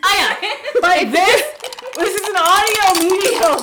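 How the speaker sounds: loud laughing scold. Real, not acted. Host scolding her friend for slurping, loud and laughing. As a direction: call her out loudly through laughter.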